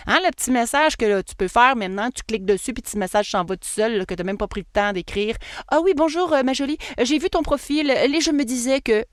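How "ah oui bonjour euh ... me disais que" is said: put-on France French accent, higher pitched; lilting imitation of a French accent. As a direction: play an automated message in a French accent. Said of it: "je prends mon accent français".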